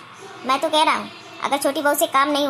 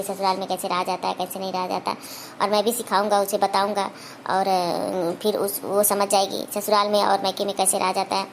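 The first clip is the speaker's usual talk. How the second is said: put-on lower, softer young husband pleading with his mother; son pleading softly; 7 semitones lower, 3 dB quieter than usual. Son Anand asks his mother to give his new wife time, low and humble. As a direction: keep it low and humble, asking for patience.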